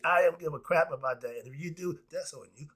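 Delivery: usual speaking voice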